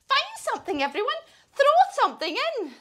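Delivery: high-pitched storybook witch. Acted. Witch orders friends to fill cauldron, high excited voice.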